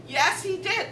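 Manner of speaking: mock-offended wife insisting; indignant insistence. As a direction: argue back, high and emphatic. Wife insists to scoffing husband.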